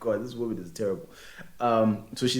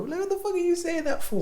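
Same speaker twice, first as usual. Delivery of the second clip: much higher, incredulous squeal; exasperated squeal. Real, not acted. Host scolding the guy in the screenshot, high squeal. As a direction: shriek it out at him in disbelief.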